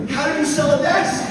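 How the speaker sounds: very high, loud incredulous question; acted husband's disbelief; astonished. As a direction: demand an explanation in amazement.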